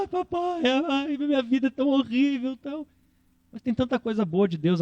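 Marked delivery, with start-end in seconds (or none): 0.0-2.9 high, self-pitying whine; mock a spoiled kid complaining; whiny child. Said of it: "Você às vezes fica só".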